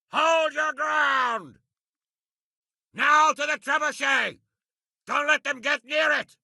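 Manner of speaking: high, shouted orders over the fighting; rally the troops, yell them forward; battle shouts. Acted. Commander urging his men to hold against reinforcements, shouting.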